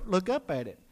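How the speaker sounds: normal talking voice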